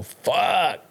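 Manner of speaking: sharp, high "Fuck" with a falling end; frustrated curse. Retold or imitated. Caller cursing when the radio call hangs up, frustrated. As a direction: spit out "Fuck" as the call drops. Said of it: "and I go".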